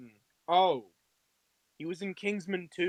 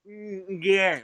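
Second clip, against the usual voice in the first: savoring "mm", then a louder, pompous yes; refined diner; 2 semitones higher, 6 dB louder than usual. Acted. Friend in imagined tuxedo cutting up pizza, mock-refined approval. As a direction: play the snooty gourmet. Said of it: "full tuxedo and garb, and you're, like".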